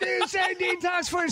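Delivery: high, drawn-out retold question; slow, high-pitched mock disbelief; baffled calling back. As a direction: imitate shouting a query to another room. Calling back to her, still baffled.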